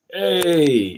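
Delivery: loud, high, breezy "Hey"; casual Dominican greeting. Shown as a demonstration. Greeting a new friend the Dominican way, loud and casual. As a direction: call out "Hey" like a Dominican buddy. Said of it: "this is how it sounds like when".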